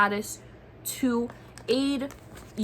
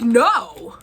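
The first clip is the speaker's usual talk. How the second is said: sharp shout; 2 semitones higher, 10 dB louder than usual. Retold or imitated. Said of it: "And I'm like".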